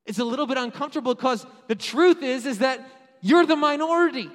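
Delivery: loud, high-pitched sermon delivery; full-force preaching. Real, not acted. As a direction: drive it out to the whole crowd.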